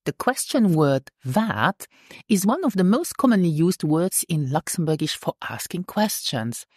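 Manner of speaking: normal talking voice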